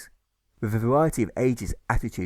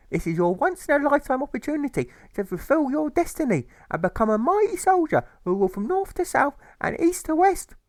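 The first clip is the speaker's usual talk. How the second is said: much higher, louder insistent urging; shrill mother; 4 dB louder than usual. Acted. Mother urging Alfred to enlist, much higher and insistent. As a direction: push him like a fanatic mother.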